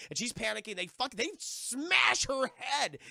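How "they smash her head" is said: loud, high, drawn-out storytelling punch; slow down and hit the violence hard; raised voice for the shocking moment; dramatic emphasis. Real, not acted.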